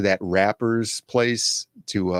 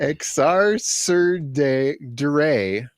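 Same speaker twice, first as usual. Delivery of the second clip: "Exarcerdere" said very slowly, syllable by syllable; painstaking slow reading; slow sounding out; 3 semitones higher, much slower than usual. Shown as a demonstration. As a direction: drag out "Exarcerdere" carefully. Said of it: "sounding out".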